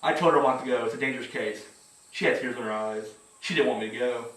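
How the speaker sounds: low, soft private eye voiceover; noir detective. Retold or imitated. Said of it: "then you hear his voice over".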